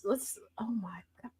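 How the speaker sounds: whisper. Real, not acted. Shocked at video.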